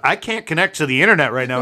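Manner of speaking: voice assistant. Retold or imitated. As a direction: announce it like a blaring speaker. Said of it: "it's like".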